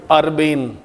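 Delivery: अर्बेन said as ur-bane with a long final e; teacher correcting अर्बन to अर्बेन; pronounce urbane. Shown as a demonstration. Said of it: "इसको अर्बेन पढ़ा जाता है".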